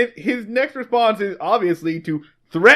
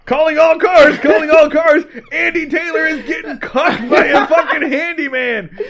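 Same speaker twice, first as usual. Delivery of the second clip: high, loud dispatcher holler; police radio call; 6 semitones higher, 5 dB louder than usual. Acted. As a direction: broadcast the gossip like an urgent bulletin. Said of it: "gets on the C B radio and".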